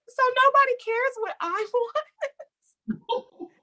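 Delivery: very high, whiny, with a laugh breaking in; self-pitying whine. Retold or imitated. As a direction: play the hard-done-by kid, half laughing. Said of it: "And I'm just like".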